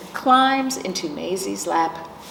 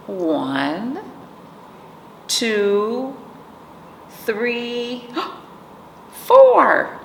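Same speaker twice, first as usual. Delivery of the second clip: counting up to a gasp; 4 semitones higher, much slower than usual. Acted. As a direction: build to a big surprised four. Counting kittens, gasp at four.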